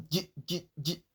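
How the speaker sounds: "G" sounded out for the DJ digraph; sound demo. Shown as a demonstration. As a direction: repeat "G" as separate, short demonstration sounds. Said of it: "D J is pronounced as".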